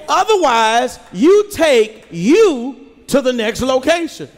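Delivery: ordinary talk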